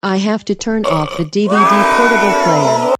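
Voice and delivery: put-on loud alarmed kid cry; panicked shouting. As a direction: yell it in a panic. Kid panics as dad comes.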